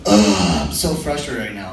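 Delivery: low, quick exasperated sigh of a line; imitated frustration. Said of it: "Whenever a girl starts off a sentence with".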